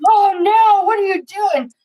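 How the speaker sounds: high, loud alarmed cry; alarmed grandma. Retold or imitated. Grandma sees grandson beaten on video, alarmed high cry. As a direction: shriek at the boys to stop.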